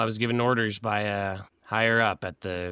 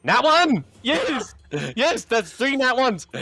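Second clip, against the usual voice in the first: real high, loud cheering; excited; 13 semitones higher, 6 dB louder, slower than usual. Real, not acted. Player celebrates three nat ones, elated. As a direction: whoop it out in triumph.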